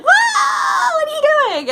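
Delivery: high, loud frightened yelp; startled cry. Retold or imitated. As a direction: squeal it like you're about to tip over backwards. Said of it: "I would be like".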